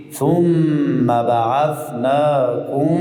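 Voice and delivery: ثم held long and nasal, then بعثناکم chanted slowly; ثم with held nasal ghunna. Shown as a demonstration. Teacher to pupils, chanting ثم with a long ghunna. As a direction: stretch the مّ of ثم for two beats of ghunna.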